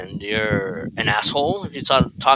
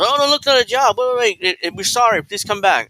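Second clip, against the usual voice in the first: high, pleading mock boss voice, much higher; panicky pleading boss; 9 semitones higher, 4 dB louder than usual. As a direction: beg him to come back in a squeaky hurry. Boss begging him back.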